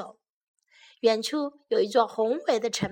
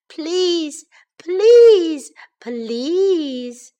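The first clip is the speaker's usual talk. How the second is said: high, loud pleading, stretched at the end; begging; 6 semitones higher, 9 dB louder, much slower than usual. A narrator's reading. Baby bear pestering parents for a chair, high begging. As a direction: beg insistently, peaking on the middle one. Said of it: "begged Baby Bear".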